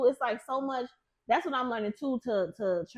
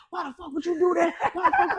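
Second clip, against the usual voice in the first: higher-pitched outraged demand; retold angry outburst, raised pitch; angry mock yell; 7 semitones higher, 4 dB louder than usual. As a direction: imitate someone yelling in outrage. Retelling furious boyfriend yelling from car.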